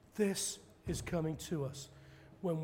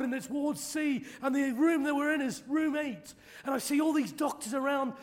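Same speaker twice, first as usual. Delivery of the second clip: loud, high, racing vision report building to a shout; see it and cry it out; high ecstatic prophecy; 9 semitones higher, 7 dB louder than usual. Retold or imitated. Evangelist relaying a vision to the crowd, high and loud.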